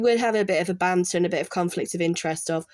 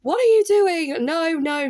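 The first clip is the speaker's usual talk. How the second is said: sharp, much higher and louder outburst of disbelief; shocked objection; 9 semitones higher, 4 dB louder than usual. Retold or imitated. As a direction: burst out at the students in alarm. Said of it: "the lecturer coming in and going".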